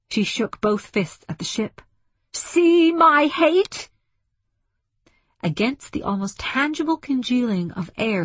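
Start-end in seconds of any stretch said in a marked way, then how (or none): 2.3-3.9 high, loud, drawn-out cry of hate; furious shout. A narrator's reading. Woman shaking both fists at the ship, furious. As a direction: hurl each word in rage.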